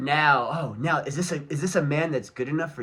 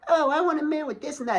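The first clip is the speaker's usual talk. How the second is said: high, far-off airhead voice mocking women; mocking girly voice; 14 semitones higher, 5 dB louder than usual. Mocking the women who talk all day: high girly voice. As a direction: sneer it out in a high, airy girl's voice.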